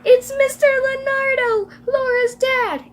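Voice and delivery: high, loud alarmed shout; screamed announcement. Acted. Emily shouts to her mom from the window, high scream. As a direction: yell it through the house at a high pitch. Girl screaming news to mother.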